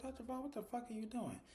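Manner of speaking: high-pitched, softened mock outcry; shocked disbelief. Viewer reacts to the doctor grabbing her arm, shocked disbelief.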